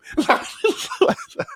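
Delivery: airy high-pitched cackle; high breathy laugh. Real, not acted. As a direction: laugh at the joke on himself. Laughs at own roast.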